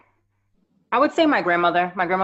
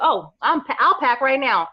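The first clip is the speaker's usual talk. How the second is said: higher-pitched, eager self-quote; retold eagerness; 6 semitones higher than usual. Graduate saying yes to the gig, eager. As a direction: jump at the offer before it goes away.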